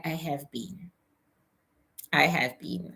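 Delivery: normal talking voice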